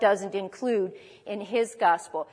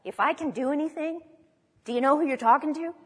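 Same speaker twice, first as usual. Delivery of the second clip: higher-pitched, disbelieving rhetorical question; mock incredulous attitude; 4 semitones higher, faster than usual. Preacher imagining Jesus answering the doubting father, mock incredulous. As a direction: toss it back as if the doubt is absurd. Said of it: "didn't have this attitude".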